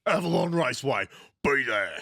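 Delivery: fast, punchy local radio ad read. Retold or imitated. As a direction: bark it like a car-yard radio ad. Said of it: "same guy that used to do the Avalon ones".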